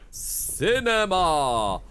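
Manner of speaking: loud, slow, drawn-out cinema; drawn-out dramatic. Pretend. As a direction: stretch cinema out grandly. Drawn-out meme take, then quiet repeat.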